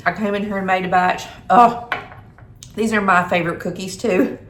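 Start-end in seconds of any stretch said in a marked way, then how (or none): none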